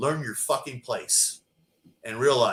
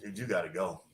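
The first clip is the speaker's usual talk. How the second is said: quiet dismissal; 5 semitones lower, 8 dB quieter than usual. Retold or imitated. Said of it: "They walk over and go".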